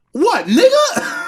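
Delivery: put-on slow, high, puzzled old-lady voice; old lady's reply. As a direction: mimic a sweet old lady casually asking back, slowly. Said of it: "She's like".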